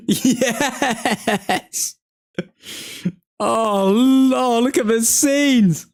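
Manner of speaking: high, slow, joyful cries with laughs; overjoyed fan cheering the late winner; elated celebration. Real, not acted.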